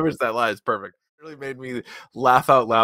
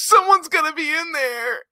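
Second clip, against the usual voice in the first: high, panicked pleading; squeaky, frantic impression; hammed-up distress; mock panic; 12 semitones higher than usual. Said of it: "He goes".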